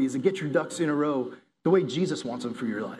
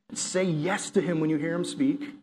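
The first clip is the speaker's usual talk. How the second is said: normal talking voice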